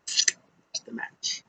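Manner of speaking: breathy, slow throwaway whisper; whispered aside. Real, not acted. Flat wrap-up aside.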